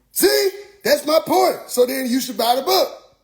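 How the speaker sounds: high, triumphant mock pitch; imitated preacher salesman. Preacher selling his book, high mock triumph. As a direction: seize the point in a high preacher voice.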